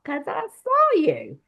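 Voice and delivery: high-pitched, drawn-out insistence; slow, high acted exclamation; emphatic retold revelation. Retold climactic revelation to officer.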